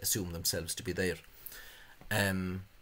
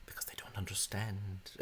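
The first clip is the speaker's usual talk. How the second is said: whisper; 9 dB quieter than usual. Real, not acted. Sly aside about the spelling's supporters.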